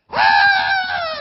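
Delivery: a sharp high shriek, very loud; sudden high cry. Retold or imitated. Possessed student crying out as prayer begins. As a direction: let out a startled scream. Paul cries out while prayed for.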